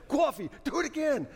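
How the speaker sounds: high, hoarse New Jersey coach's yell; New Jersey accent. Retold or imitated. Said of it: "he had a New Jersey accent".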